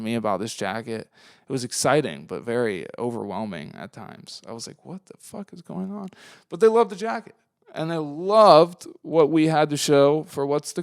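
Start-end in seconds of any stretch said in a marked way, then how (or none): 4.8-6.1 much softer, higher bewildered aside; overwhelmed murmur. Retold or imitated. Speaker recalling being mobbed by the crowd, soft and baffled. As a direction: mutter it under your breath in disbelief.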